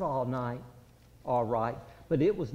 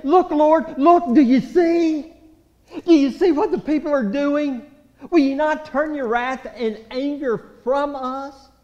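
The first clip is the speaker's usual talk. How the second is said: desperate loud plea to God; 9 semitones higher, 11 dB louder than usual. Acted. Jerusalem crying out to God, loud and desperate. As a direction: cry it out loud and pleading. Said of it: "They're saying".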